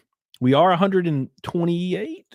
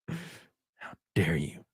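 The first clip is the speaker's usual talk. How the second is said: mock outrage; 8 semitones lower, 9 dB quieter, much slower than usual.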